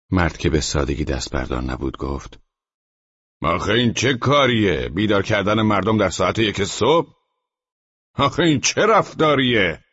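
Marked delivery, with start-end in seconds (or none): 3.4-9.8 high-pitched, exasperated reproach; outraged rhetorical questions; indignant scolding. A narrator's reading. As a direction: demand an explanation, pitch climbing. Persistent neighbour scolds the tenant.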